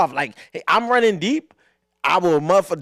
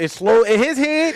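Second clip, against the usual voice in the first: drawn-out, slightly raised and louder; slow-motion storytelling; 6 semitones higher, 5 dB louder than usual. Retold or imitated. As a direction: stretch it out for suspense. Dramatising a slow-motion moment.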